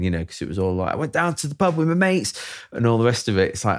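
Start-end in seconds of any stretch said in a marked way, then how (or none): 0.9-2.3 put-on English pub-lad accent, raised pitch; mock English bloke boasting about the pub; playful English accent; imitating English accent. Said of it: "accent, you were fine".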